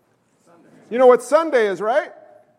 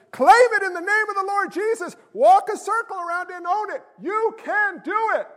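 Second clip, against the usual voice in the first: high, hammed-up inspirational hype; sustained high rallying cry; motivational speaker; 9 semitones higher than usual. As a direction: exhort them like a self-help preacher. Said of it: "you ever listen to a motivational speaker".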